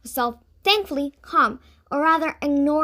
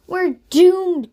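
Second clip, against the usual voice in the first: mild despairing cry; 6 semitones higher, 9 dB louder than usual. A narrator's reading. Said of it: "cried Natasha".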